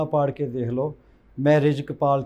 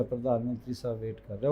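quiet lower echo; 6 semitones lower, 13 dB quieter, faster than usual. Retold or imitated. Singer tells interviewer the prime minister waited, repeating it softer and lower.

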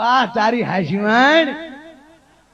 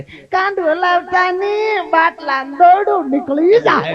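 nagging visitor aunty; 7 semitones higher, slower than usual. Retold or imitated. Women visitors fussing at patient.

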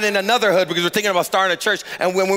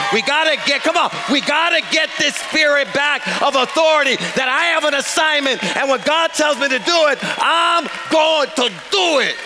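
high, impassioned pulpit voice; fervent preaching; 6 semitones higher than usual. Real, not acted. Pastor rallies church to reclaim authority, fervent preaching. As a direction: drive it home to the congregation, building to the last words.

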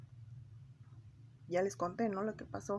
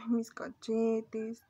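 whiny lament; 2 semitones higher, 6 dB louder, much slower than usual. Retold or imitated. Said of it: "Antes yo decía".

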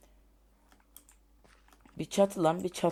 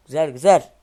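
loud happy shout of approval; excited cheer; 16 dB louder than usual. Real, not acted. Gamer cheering his roof loudly.

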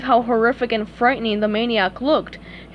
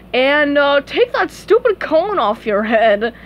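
loud, slow, pitched-up parting order; scornful cop; 4 semitones higher, 5 dB louder, slower than usual. Acted. Other cop adds a jab about the cone, scornful. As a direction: tack it on loudly and slowly.

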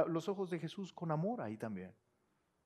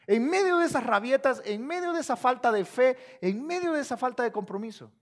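loud, driving sermon build; ringing preacher's cry; fiery preaching; 6 semitones higher, 9 dB louder than usual. Acted. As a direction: hammer each phrase from the pulpit. Preaching at full force.

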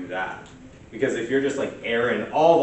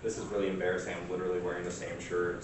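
ordinary talk

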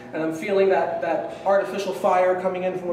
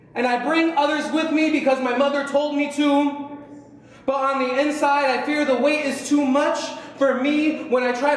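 driving, pitched-up poetry performance; spoken-word poetry; 6 semitones higher than usual. Acted. Poet reciting to an audience about a changing city, spoken word. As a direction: declaim it like a slam poem. Performs own poem on stage.

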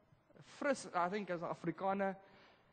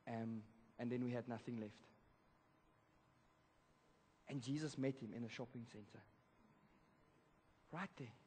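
usual speaking voice

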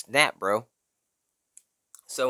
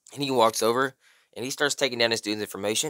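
ordinary delivery, nothing marked

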